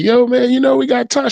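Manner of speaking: telling his wife they have Tongan roots; excited news. Retold or imitated. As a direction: burst out with the news.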